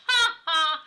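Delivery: loud jeering ha ha; loud mocking laugh. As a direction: laugh in his face. Boy mocks the story.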